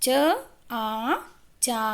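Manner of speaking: usual speaking voice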